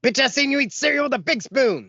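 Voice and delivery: high-pitched, hammed-up call-out; mock accusation. Friend calling out the big spoon chick, mock accusing. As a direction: throw it out as a comic accusation.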